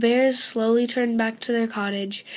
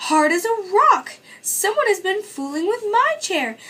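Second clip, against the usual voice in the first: mama bear; 9 semitones higher, 3 dB louder than usual. A narrator's reading. Mama Bear gasped, complaining.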